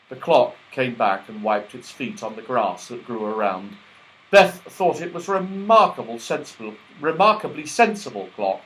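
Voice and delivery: usual speaking voice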